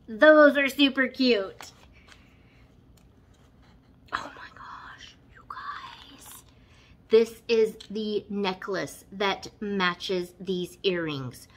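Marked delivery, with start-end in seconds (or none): 4.1-6.4 breathless awed whisper. Real, not acted. Vlogger seeing matching necklace, awed whisper. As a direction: whisper it in awe.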